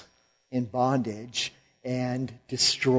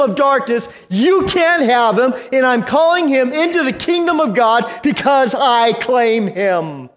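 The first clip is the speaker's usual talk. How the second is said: high, loud fiery sermon; full-force preaching; 10 semitones higher, 6 dB louder than usual. Acted. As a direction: preach it with rising fire. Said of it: "preaching at full force".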